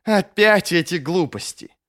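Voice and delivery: exasperated. A narrator's reading. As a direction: brush it off as tiresome nonsense. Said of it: "Халлек закатил глаза".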